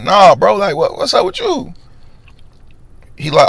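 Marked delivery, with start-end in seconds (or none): 0.0-1.7 asking what's wrong with him, high and baffled; disbelieving squeak; incredulous. Retold or imitated. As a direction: pitch jumps way up in disbelief. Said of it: "I'm like".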